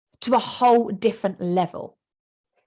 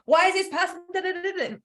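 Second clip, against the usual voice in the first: much higher, confused and puzzled; the unconscious mind confused, pitched way up; baffled squeak; 10 semitones higher than usual. Pretend. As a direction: squeak it out, totally lost. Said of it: "So it's going".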